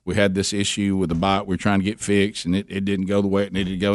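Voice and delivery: ordinary talk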